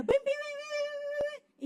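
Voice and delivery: high, quick pim pim punches; punch sound effects. Retold or imitated. Preacher imitating McGregor's punch flurry, high and quick. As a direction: rattle off the punches like a flurry of blows.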